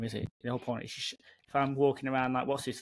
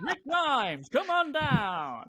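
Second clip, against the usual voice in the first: high, slow booming host call; X Factor announcer; 11 semitones higher, much slower than usual. Pretend. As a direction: call him down like a game show host. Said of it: "I am the X Factor man".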